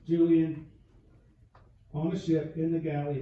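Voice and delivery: ordinary talk, nothing marked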